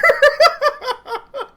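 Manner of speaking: high, loud real laugh; a sudden loud squeal of laughter; burst of laughter. Real, not acted. Amused by the name, laughing out loud.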